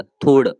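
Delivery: थोड़ said with a nasal ending on the final ड; nasal dot on ड. Shown as a demonstration. Teacher models थोड़ with its nasal end for learners. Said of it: "ड पे बिंदी आएगा".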